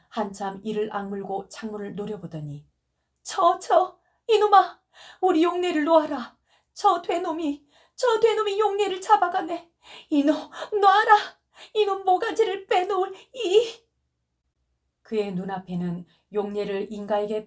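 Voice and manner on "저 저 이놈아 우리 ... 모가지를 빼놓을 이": high, loud raging scream with sobs; furious screaming. Acted. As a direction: scream at the kidnapper to let her go. Mother rages at kidnapper vision. (높고 크게 울부짖는 분노의 비명)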